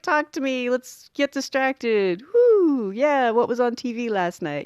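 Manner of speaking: hammed-up plea to be distracted; half-joking patient begging to talk; nervous chatter. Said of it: "You know, like".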